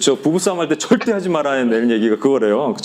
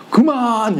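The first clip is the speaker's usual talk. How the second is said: retold shout; 8 semitones higher, much slower than usual. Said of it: "이랬어요".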